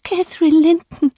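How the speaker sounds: put-on high, tremulous ghost child; trembling answer. A narrator's reading. Ghost child tells the frightened dreamer its name, trembling. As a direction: answer in a high, quivering little voice. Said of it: "antwortete es bebend".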